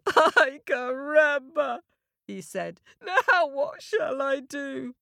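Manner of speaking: put-on high, slow crying child; crying boy. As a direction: wail it like a little boy in tears. Little boy keeps crying.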